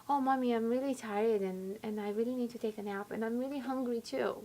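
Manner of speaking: soft, small confession to Mommy; calmed-down child. Retold or imitated. Six-year-old telling mother his needs, softly imitated. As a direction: own up quietly like a tired little kid. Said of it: "And you say".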